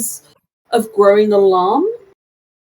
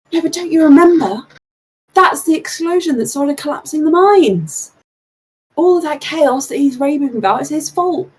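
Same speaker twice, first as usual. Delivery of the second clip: accusing townsperson; 6 semitones higher than usual. Acted. Second crowd voice by GM.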